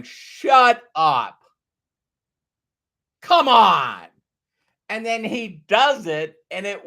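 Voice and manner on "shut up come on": louder, amazed shut up, come on; a hammed-up can't-believe-it; mock disbelief. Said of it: "he's going".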